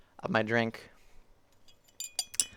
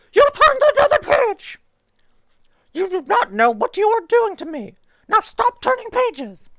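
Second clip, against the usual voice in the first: very high and much louder, alarmed puppet; Grover voice; 9 dB louder than usual. Acted. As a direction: scold the reader in a frantic high squeak. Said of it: "tried to do the Grover voice".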